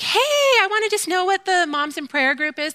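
high, bright-voiced polite caller, a touch fast; chirpy phone voice. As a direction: play the overly cheerful mom on the phone. Mock phone call to school.

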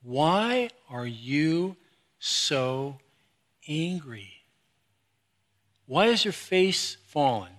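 slow, soft asking of why; gentle God's question. Retold or imitated. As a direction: ask it slowly, like a patient father. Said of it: "It's God's question".

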